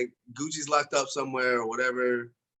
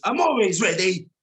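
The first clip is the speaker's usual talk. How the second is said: put-on louder, higher botched Russian accent; badly faked Russian, hammed up; 4 semitones higher, 6 dB louder than usual. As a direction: attempt a Russian accent and miss. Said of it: "That was not Russian".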